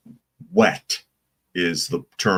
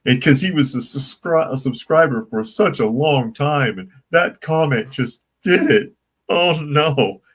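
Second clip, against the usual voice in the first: high, louder, laughing delivery; amused mockery; 7 semitones higher, 5 dB louder than usual. Real, not acted. Host making fun of an unsubscriber, amused mockery. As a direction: raise the pitch, tickled and mock-dismayed. Laughing, mocks an offended subscriber.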